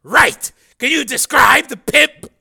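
loud, high barking chief; gruff police chief. Acted. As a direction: bark like a surly boss.

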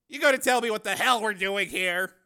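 loud, high-pitched impatient question; exasperated demand. A narrator's reading. Cameraman questions reporter about the stakeout, exasperated demand. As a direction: demand to know what's going on.

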